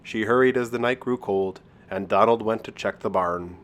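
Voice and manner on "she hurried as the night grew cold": plain reading voice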